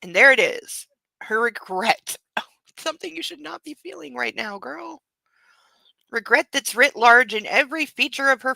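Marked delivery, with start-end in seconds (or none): 2.8-5.0 soft aside. Real, not acted.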